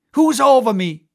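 loud high outburst; defiant shout. Acted. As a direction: roar it out in defiance.

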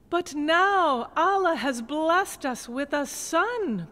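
put-on a grateful old gardener rejoicing; joyful cry. A narrator's reading. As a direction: gardener telling his wife the good news, rejoicing slowly. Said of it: "cried the old gardener to his wife".

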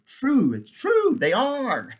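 very high, loud delighted squeal; playful exclamation. Real, not acted. Reader agreeing about cliffhanger chapters, playfully excited. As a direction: burst out high and emphatic.

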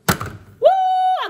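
high, sustained "woo"; excited whoop. Real, not acted. Showing viewers the good old milk, excited whoop. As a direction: let out a long high whoop.

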